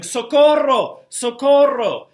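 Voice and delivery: high, loud cry of socorro; panicked Spanish cry for help; yelling for help. Retold or imitated. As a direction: shout for help from the ceiling. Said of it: "He's yelling".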